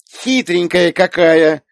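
put-on high, loud, slow childish outrage; indignant little girl. A narrator's reading. Little girl protests at her aunt, indignant. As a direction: draw out a small girl's protest. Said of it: "возмутилась девочка".

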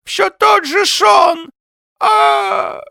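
high, slow, loud lisping wail; despairing old man. A narrator's reading. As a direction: wail it out, ending in a cry. Old man laments his recurring dream.

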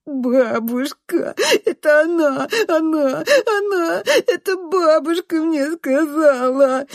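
high, drawn-out, tearful outcry; wailing child. A narrator's reading. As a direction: wail and put the blame on grandma. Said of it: "надрывается он". (надрывно воет, оправдываясь)

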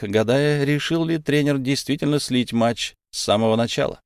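usual speaking voice

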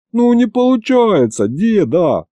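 high, drawn-out howl of helplessness; whining wail.

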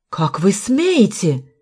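slow, drawn-out outrage; a contained flare of anger; boiling indignation. A narrator's reading. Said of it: "вскипел Фарвелл". (протяжно, с возмущением)

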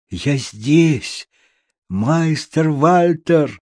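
mild storybook shout, drawn out; calling out. A narrator's reading. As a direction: call the name long, as if from afar. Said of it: "крикнул я, что было мочи".

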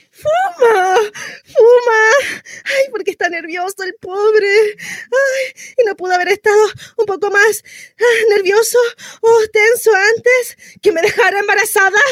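high, tearful sarcastic outrage; wailing exasperation. Acted. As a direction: rail at the absent partner through labour pains. Labouring woman furious at smoking partner. (indignación llorosa, voz aguda)

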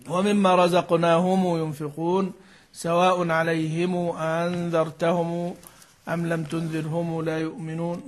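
a slow, lightly chanted verse read; Quran recitation. Acted. Teacher quoting verses in a light recitation style.

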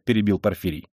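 ordinary delivery

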